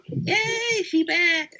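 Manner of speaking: high excited squeal of joy. Retold or imitated. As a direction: squeal it like a thrilled fan. Retold joy at return.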